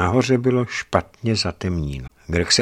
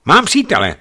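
wife voiced; 10 semitones higher, 7 dB louder than usual. Greg voices his wife's words.

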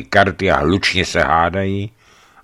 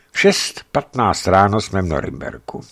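ordinary delivery, nothing marked

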